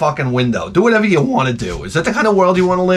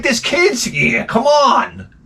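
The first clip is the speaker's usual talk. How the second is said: high, drawn-out indignant plea; exasperated protest; 9 semitones higher, much slower than usual. Retold or imitated. Comic imagining himself scolding paraders near kids, exasperated. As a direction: object with the voice pitched right up.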